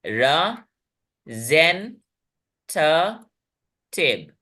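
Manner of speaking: र, जेन, थ, टिव with gaps between; spoken in segments. Shown as a demonstration. Teacher breaking a big word into parts, र-जेन-थ-टिव separated. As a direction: give each syllable र, जेन, थ, टिव its own beat. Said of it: "बड़े बड़े शब्दों को हम खंड में बोलेंगे".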